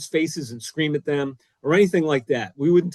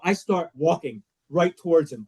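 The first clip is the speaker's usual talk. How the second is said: ordinary delivery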